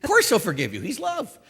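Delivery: ordinary delivery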